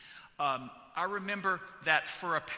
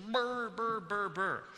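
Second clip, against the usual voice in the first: shivering brr; 5 semitones higher, slower than usual. Retold or imitated. Imitating shivering in the cold.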